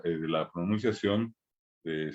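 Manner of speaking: usual speaking voice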